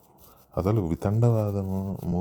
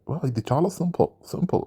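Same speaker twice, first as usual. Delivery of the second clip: put-on high voice; mocking catchphrase imitation; 7 semitones higher than usual. Reviewer mimics contestants who simplify everything, mocking imitation. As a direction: imitate them making light of it, pitched up. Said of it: "వీళ్ళు, వీళ్ళు చాలా simplify చేసి".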